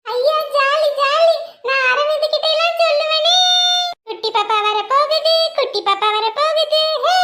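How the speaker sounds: put-on high sing-song little girl chanting; excited child. The girl chants happily about carrying the baby, high sing-song. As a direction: chant it like a thrilled kid.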